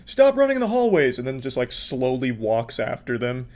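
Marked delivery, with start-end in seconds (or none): 0.1-1.2 higher-pitched officious scolding; hammed-up hallway reprimand; mock hall monitor. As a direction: imitate a fussy hall monitor calling out. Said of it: "just like a hall monitor".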